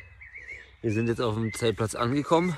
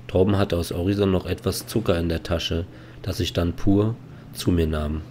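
voiceover narration; 3 semitones lower, 4 dB louder than usual. A narrator's reading.

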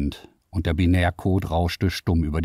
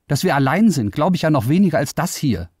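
louder, faster, higher protest; defiant outburst; 9 semitones higher, 4 dB louder, faster than usual. Acted. Liara rejects being alone in the universe, defiant. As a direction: hurl the disbelief at them.

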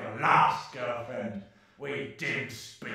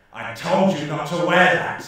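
raised angry reproach; angry scolding; 3 semitones higher, 10 dB louder than usual. Acted. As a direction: snap at him for disobeying. Scolding about makeup.

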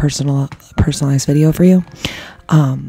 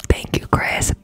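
ear cleaning asmr; 3 semitones higher than usual. Real, not acted.

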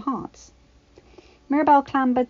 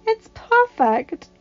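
delighted praise; 13 semitones higher, 7 dB louder, much slower than usual. A narrator's reading. Mother admiring the room.

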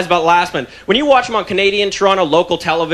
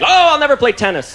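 mock soldier lament; 7 semitones higher than usual.

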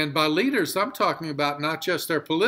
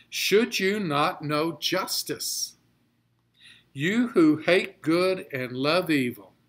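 scripture reading. Acted. Reads scripture in heightened voice.

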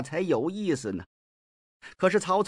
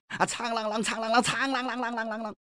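sword-ringing noise; 6 semitones higher, 4 dB quieter than usual. Acted. Swords drawn, sound imitated.

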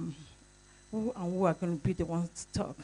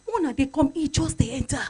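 higher and louder urgent call; loud, high insistent summons; calling out again; 7 semitones higher, 8 dB louder than usual. Retold or imitated. Wife calling the senator down again, higher and louder. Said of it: "And was like".

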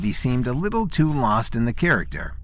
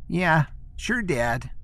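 put-on higher, deflated kid answer; glum childlike voice; 7 semitones higher, slower than usual. A narrator's reading. Man forced to play a child answering his father, glum. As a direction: say it like a sulky boy. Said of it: "Resuming his childlike voice, he glumly replied".

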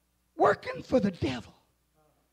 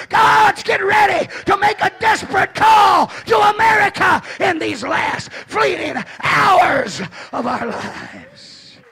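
loud, high, rising sermon shout; full-force preaching; 10 semitones higher, 9 dB louder than usual. Acted. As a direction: preach it hard to the congregation.

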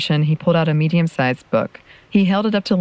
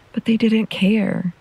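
put-on hurt boy; 7 semitones higher, slower than usual. A narrator's reading. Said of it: "he lowered his voice".

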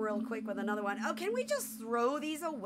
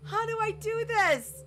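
despairing wail; 10 semitones higher, 4 dB louder than usual. Real, not acted. Despairs at game with no power.

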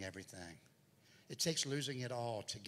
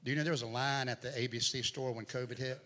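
loud, driving sermon delivery; preaching at full force; 4 semitones higher, 7 dB louder than usual. Acted. Pastor preaching his daughter's hospital story, loud and driving. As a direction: push the story out to the congregation.

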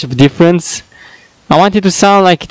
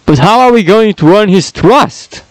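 ordinary talk, nothing marked

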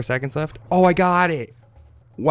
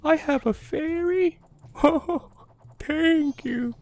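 much higher, silly squeaky fairy fan with a giggle; Tingle voice; 3 dB louder, slower than usual. Pretend. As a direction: squeal it like a giddy fairy-obsessed man. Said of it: "He'll be like".

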